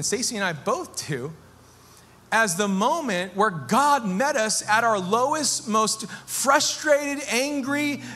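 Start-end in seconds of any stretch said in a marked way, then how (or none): 2.3-8.0 rising sermon passion. Acted. Pastor to his congregation, preaching with rising fervor. Preaching builds at full force.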